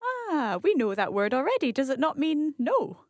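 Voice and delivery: ordinary talk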